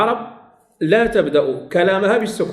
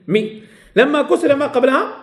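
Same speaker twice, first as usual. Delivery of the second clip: ordinary talk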